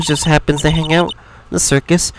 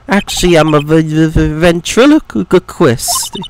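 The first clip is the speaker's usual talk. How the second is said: slow stutter stuck on the v of ventriloquist; halting, anxious repeats before ventriloquist; nervous stammerer. Acted. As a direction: struggle to get ventriloquist out. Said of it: "This guy is so nervous".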